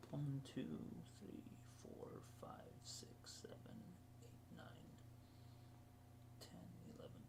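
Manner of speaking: counting under breath; whispered counting. Real, not acted.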